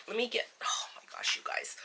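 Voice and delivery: quick whispered fluster. Real, not acted. Fumbling, embarrassed aside.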